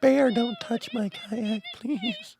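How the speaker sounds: high, slow, soft mock plea; mocking whiny woman. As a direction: simper like a helpless tourist begging.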